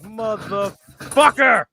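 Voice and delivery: slow, drawn-out exasperated curse. Real, not acted. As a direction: drag it out in disbelief. Exasperated about the movie.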